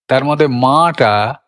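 usual speaking voice

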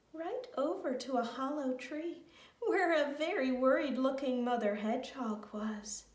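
ordinary delivery

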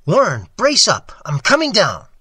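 loud, high call to a friend below; resolute shout. A narrator's reading. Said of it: "I formed and shouted a resolution".

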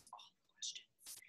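slow breathy whisper under the breath; whispered aside. Shown as a demonstration. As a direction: whisper it to yourself, slowly.

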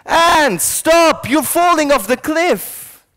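loud, high, drawn-out shout; high, urgent holler from afar; shouting a warning. Acted. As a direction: yell at them to stop, stretching the words. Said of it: "by shouting at them".

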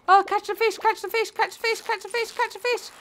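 high, pattering repeated chant; urgent repeating. Real, not acted. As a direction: urge yourself on without a break. Urges self during fishing minigame.

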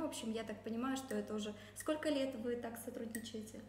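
ordinary talk

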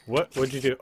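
normal talking voice

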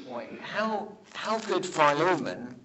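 normal talking voice

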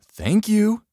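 high, loud mock thanks; sugary-high sarcastic thanks. A narrator's reading. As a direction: thank them with clear sarcasm. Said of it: "with a clear hint of sarcasm".

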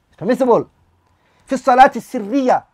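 imam raising his voice slightly. Retold or imitated. Teacher imitating imam raising voice in silent prayer. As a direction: lift the voice a notch. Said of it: "puts his voice up a bit and he goes".

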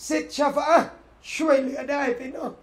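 ordinary talk, nothing marked

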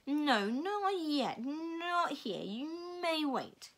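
put-on slow otherworldly old voice; Missus Which voice. A narrator's reading. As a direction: drag each word out like an ancient being. Missus Which speaks in story.

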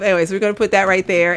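normal talking voice

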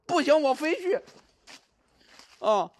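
high, defiant insisting; stubborn insisting. Real, not acted. Streamer defying the ghost's pull, high stubborn insisting. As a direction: refuse to give in, pitch up.

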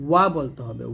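ordinary delivery

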